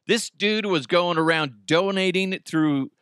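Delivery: usual speaking voice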